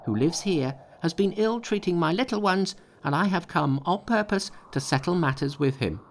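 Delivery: high-pitched defiant monkey voice; a cocky high challenge. A narrator's reading. Monkey's message to the imps.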